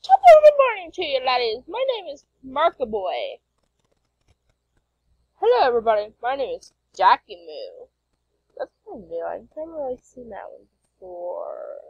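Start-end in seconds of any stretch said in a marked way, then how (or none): 0.0-3.4 high, loud hammed-up Irish impression; mock Irish greeting. Mark parodying Jack's greeting, hammed-up Irish voice. 5.3-7.9 booming hello, then settling down; parody video intro. Acted. Jack parodying Mark's intro, hammed-up host voice.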